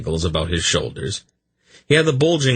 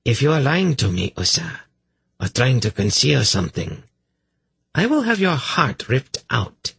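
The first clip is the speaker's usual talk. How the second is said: higher-pitched, mildly menacing priestess; threatening; 7 semitones higher than usual. A narrator's reading. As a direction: warn a suspected liar, with understated menace. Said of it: "she threatened".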